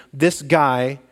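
ordinary delivery

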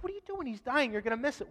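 high-pitched mock panic; nervous disciples. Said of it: "Makes everybody nervous".

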